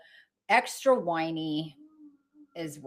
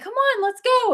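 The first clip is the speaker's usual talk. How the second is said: much higher, louder sing-song coax; coaxing call; 8 dB louder than usual. Retold or imitated. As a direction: coax the cat out cheerfully. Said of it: "And I'm like".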